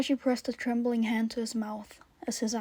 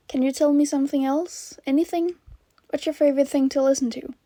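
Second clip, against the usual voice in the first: louder, higher coaxing; bright encouragement; 4 semitones higher, 8 dB louder, faster than usual. A narrator's reading. Host coaxing scared child on the line, encouraging. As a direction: keep the child talking, voice lifted and loud.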